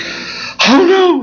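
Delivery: high, squeaky "Oh no" in sudden alarm; Miriam's panic. Retold or imitated. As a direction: imitate a frightened girl spotting people coming. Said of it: "She's like".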